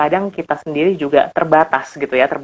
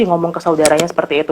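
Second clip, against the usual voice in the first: usual speaking voice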